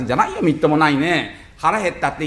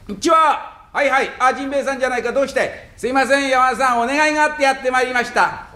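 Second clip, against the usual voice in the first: high visiting voice; 8 semitones higher than usual. Acted. Visit at Yamada's house, high voices.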